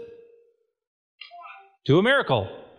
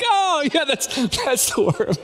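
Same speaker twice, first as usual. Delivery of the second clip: much higher, embarrassed and giggly; sheepish laugh; 12 semitones higher, slower than usual. Retold or imitated. To the waiter, sheepish and laughing at his lost word. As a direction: laugh at yourself for forgetting tortillas.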